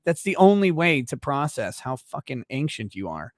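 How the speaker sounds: usual speaking voice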